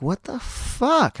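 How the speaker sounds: high-pitched, drawn-out shocked reaction; startled disbelief. Retold or imitated. Driver hears something hit his car, high stretched disbelief. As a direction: let it stretch and shoot up in alarm.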